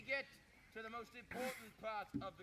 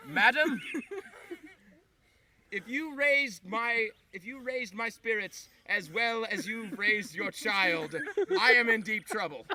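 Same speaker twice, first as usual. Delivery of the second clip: slow, loud courtly wooing; hammed-up flirt with a mother; mock gallant suitor; 14 dB louder, slower than usual. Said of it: "I had a joke for this".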